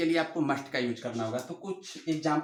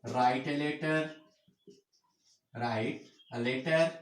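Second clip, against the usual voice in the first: very slow, word by word; slow dictation; 3 semitones lower, much slower than usual. Shown as a demonstration. Teacher dictating to pupils, very slow. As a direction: drag each word out so they can write it.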